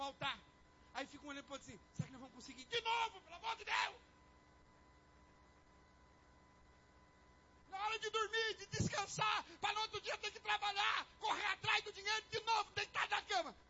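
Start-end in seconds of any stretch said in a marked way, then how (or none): 1.9-13.5 high-pitched, exasperated outburst; exasperated bedtime complaint. Acted. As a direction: wail about money worries at bedtime. Acted couple arguing in bed.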